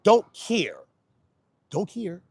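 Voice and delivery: loud, high insistence; emphatic. Real, not acted. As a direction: hit it hard. Repeated "don't care", fading.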